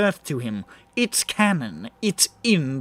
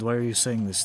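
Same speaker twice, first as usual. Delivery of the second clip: ordinary talk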